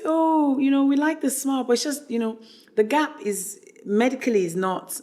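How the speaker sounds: high, polite, apologetic agent; agent's voice. Retold or imitated. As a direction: gently let her down, high-pitched. Models' agents quoted, turning her down.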